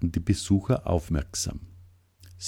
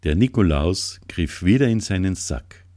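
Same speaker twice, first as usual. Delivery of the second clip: ordinary delivery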